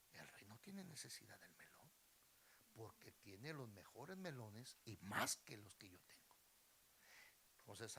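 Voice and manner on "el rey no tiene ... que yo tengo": low, hushed thinking aloud; private musing. Acted. Beggar weighing his melon alone, hushed musing. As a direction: reason softly to yourself. Beggar reasons to himself in story.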